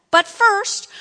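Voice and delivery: "but first" lifted high and loud; emphatic stress. A narrator's reading. Preacher repeats the key words for listeners, "but first" stressed. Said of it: "underline that".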